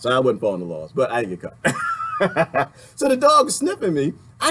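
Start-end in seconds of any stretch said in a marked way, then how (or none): 1.8-2.6 sudden squeaky laugh; high burst of laughter. Real, not acted. Laughing at own confession to the listener, high squeal.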